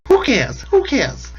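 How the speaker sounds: high-pitched mock squawk; Joy Behar impression. Podcaster repeats the sketch impression for listeners, high squawk. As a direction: squawk it high, falling off at the end. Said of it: "imitating Joy Behar".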